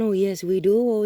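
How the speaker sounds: ordinary talk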